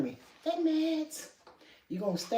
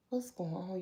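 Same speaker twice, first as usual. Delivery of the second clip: usual speaking voice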